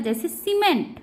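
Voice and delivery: ordinary delivery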